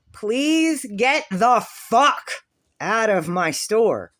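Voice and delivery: finally losing it and throwing him out; furious order. Retold or imitated. Finally snapping at the man.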